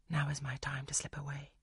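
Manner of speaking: breathy inner whisper; whispered to herself, unvoiced; whispered thought. A narrator's reading. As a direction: hush it as a private thought. Heroine's secret thought, slipping away.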